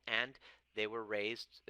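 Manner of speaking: ordinary talk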